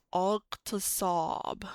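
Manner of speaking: "Iqtisab" slow, with a heavy emphatic S; slow demo. Shown as a demonstration. Teacher slowly saying a vocabulary word for students. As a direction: drag out "Iqtisab" syllable by syllable. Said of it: "You heard that?".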